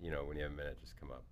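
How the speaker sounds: very soft, low father voice; hushed, ominous restraint; upset dad. Retold or imitated. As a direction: imitate a dad quietly summoning his son. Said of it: "in this tone".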